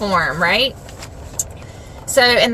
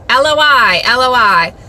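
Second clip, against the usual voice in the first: loud, declaimed cry from the cross; 6 dB louder than usual. Acted. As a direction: proclaim the scripture cry loudly. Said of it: "Jesus cried out in a loud voice".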